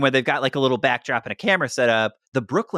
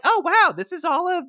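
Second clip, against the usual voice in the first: much higher, gushing mock-parent voice; mock cheery parents; 7 semitones higher than usual. Parents greeting their son's guests, gushing and high-pitched. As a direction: play the eager, welcoming Ivy League mom and dad. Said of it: "His parents seemed so nice and were like".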